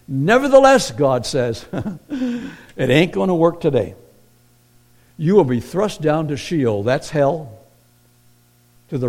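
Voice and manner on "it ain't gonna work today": ordinary talk, nothing marked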